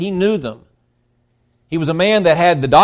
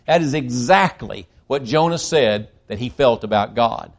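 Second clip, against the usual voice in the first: usual speaking voice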